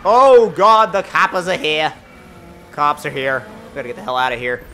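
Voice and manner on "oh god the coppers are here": loud British exclamation, falling back; bad British accent. Driver spotting police behind him, hammed-up bad British accent. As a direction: attempt a horrible British accent on the coppers. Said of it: "attempted horrible British accent".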